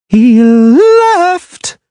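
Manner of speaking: astonished, high trilling mermaid question; high trill of astonishment. A narrator's reading. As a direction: trill it up in disbelief. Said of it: "in a high trill of astonishment".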